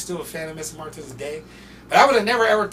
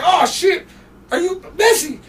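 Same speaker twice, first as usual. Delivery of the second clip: high, loud startled cry; shocked disbelief; 12 dB louder than usual. Retold or imitated. Tone G finding out the rapper is calling him, a loud, high shocked cry. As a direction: yelp it like you can't believe who's on the line. Friend realises [name] is calling.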